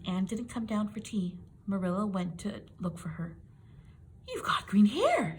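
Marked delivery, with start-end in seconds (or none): 4.3-5.4 loud, high, slow shocked cry; aghast exclamation; gasped. A narrator's reading. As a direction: recoil in horror at the sight. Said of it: "she gasped".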